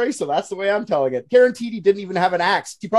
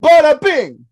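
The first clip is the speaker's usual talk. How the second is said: a loud, higher, hammed-up mafia "bada bing"; mock mobster exclamation; 9 semitones higher, 12 dB louder, slower than usual. Joking with friends about The Godfather, loud mock mobster shout. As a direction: belt it out like a movie wiseguy. Mob movie joke after Godfather.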